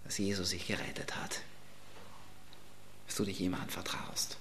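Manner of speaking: breathy hushed whisper. Real, not acted. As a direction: drop to a whisper for a private word. Preacher drops into hushed aside. (geflüstert)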